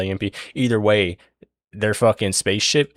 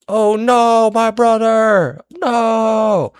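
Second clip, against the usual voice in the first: high, loud hammed-up wail; drawn-out, over-dramatic cry of grief; mock anguished cry; 14 semitones higher, 7 dB louder, much slower than usual. Voicing Holiday watching her brother die, high mock wail.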